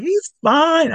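very high, mock-breezy wife voice; hammed-up high-pitched dismissal; imitating laughing wife. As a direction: squeal it, brushing off the worry. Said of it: "she's laughing and going".

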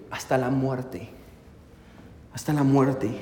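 slow, sinking to a hushed, low repeat; retold anguish. Preacher quoting Jesus to his disciples, in subdued anguish. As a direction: voice Jesus's sorrow, letting it drop away softly. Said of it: "¿Cuán grande era su angustia?".

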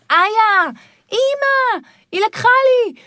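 put-on a child telling on a sibling to mom, whining; whiny child. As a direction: complain like a little kid running to mother. Child complaining to mother.

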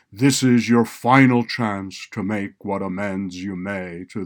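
slow, loud, commanding judge; loud stern judge. A narrator's reading. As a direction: pronounce it loudly from the bench. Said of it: "raising his voice".

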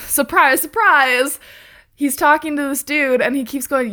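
ordinary talk, nothing marked

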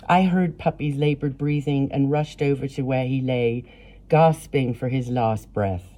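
ordinary delivery

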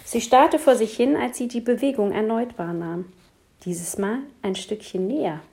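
ordinary delivery, nothing marked

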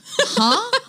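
high, puzzled "Huh?"; a high, incredulous huh; baffled huh. Retold or imitated. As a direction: act totally thrown by the news. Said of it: "And I was like".

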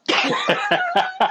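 sudden high cackle of a laugh; real amused cackle; high burst of laughter. Real, not acted.

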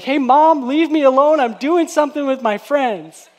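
loud, high annoyed yell; mock shout. Preacher acting a son shouting to Mom, mock annoyed shout. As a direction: shout across a crowd like a put-out son. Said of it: "like, shout across everyone".

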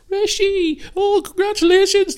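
high squeaky mock fawning voice; mocking the crowd in a high falsetto. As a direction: gush it like a starstruck fan, way up high. Crowd greeting Rishi, mocked.

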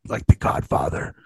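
low whispered Godfather croak. Retold or imitated. After neck surgery, imitating the Godfather in a low whisper. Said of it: "I literally was like".

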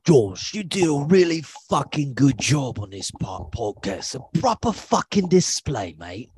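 hammed-up British impression; exaggerated British accent, swooping pitch. Shown as a demonstration. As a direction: gush like a sweary British bloke. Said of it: "That wasn't too bad".

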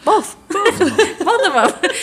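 high laughing insistence. Retold or imitated. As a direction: giggle through the answer. Retold answer, laughing.

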